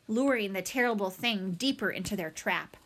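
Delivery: ordinary delivery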